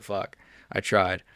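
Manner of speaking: ordinary talk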